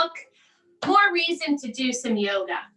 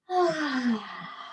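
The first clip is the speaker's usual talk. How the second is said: slow sigh. Shown as a demonstration. Kids yoga, big breath out.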